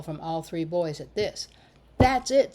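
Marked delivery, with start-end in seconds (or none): 2.0-2.5 higher, louder burst; boy jumping in excitedly. A narrator's reading. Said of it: "said Carrot excitedly".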